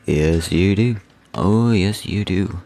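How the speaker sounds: high cooing voice to the money; cooing baby talk. Acted. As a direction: coo as if to a pet.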